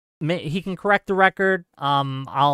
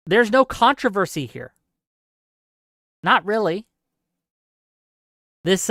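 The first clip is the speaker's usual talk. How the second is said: normal talking voice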